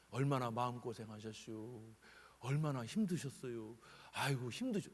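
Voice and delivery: doctor gently consoling the family; comforting doctor. Retold or imitated. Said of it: "그런데 그들을 위로하잖아".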